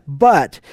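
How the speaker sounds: a drawn-out, weighty "but" as the turning point; stress on BUT. Acted. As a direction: lean hard on "but" and let it stand alone. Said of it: "this is such a huge but".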